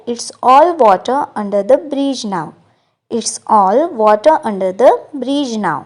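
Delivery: slow, clear English model line; slow model sentence. Shown as a demonstration. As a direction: spell it out gently for learners. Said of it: "English में इसे कहते हैं". (धीरे और साफ़ अंग्रेज़ी वाक्य)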